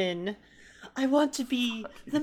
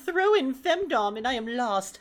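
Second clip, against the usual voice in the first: normal talking voice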